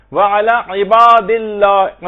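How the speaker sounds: عباد with a clear throaty ain, drawn out slowly; slow careful recitation; clear ain sound. Shown as a demonstration. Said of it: "عین کی آواز نکلنی چاہیے".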